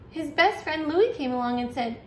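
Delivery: usual speaking voice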